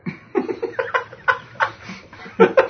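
shrieking breathy laugh; high burst of laughter. Real, not acted. Reacts to exile joke.

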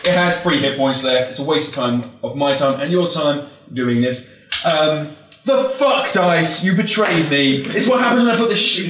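normal talking voice

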